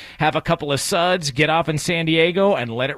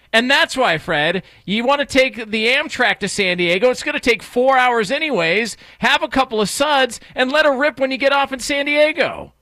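louder, higher, eager pitch; hyped setup; 7 semitones higher, 3 dB louder than usual. Pretend. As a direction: sell it like a promo. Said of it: "I'll set you up for it".

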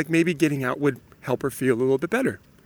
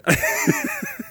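high laugh; 3 dB quieter than usual. Real, not acted. Laughs at own impression.

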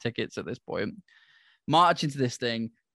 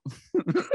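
quick high laugh at himself; short laugh; 7 semitones higher than usual. Real, not acted. Laughing at his own outburst, short high laugh. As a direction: let a laugh slip out.

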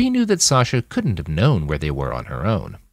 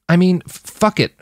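high-pitched, catching on the first sound of "fuck"; stumbling over "fuck"; 12 semitones higher, 4 dB louder than usual. A narrator's reading. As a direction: stumble on the swear word, then push it out. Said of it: "she stumbled over the fuck".

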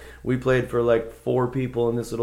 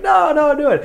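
much higher, louder insistent mimic; urging band; 11 dB louder than usual. Bandmates urging him to play, high insistent. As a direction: push him to play with high eager voices.

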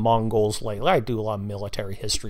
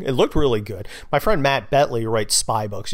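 usual speaking voice

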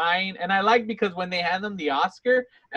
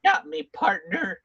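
high, slow dying-cowboy drawl; mock cowboy's last words; 9 semitones higher, slower than usual. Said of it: "he turns into a cowboy".

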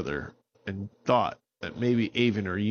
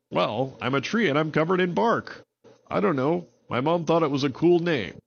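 higher, friendly tree voice; tree character voice; 7 semitones higher, 4 dB louder than usual. Acted. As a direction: play the talking tree with easy charm. Barks explains his name.

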